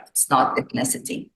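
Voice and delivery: normal talking voice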